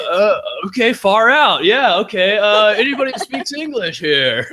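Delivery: imitated laid-back character caught off guard; Crush. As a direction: play a thrown performer stalling for help. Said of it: "Virtual Crush is like".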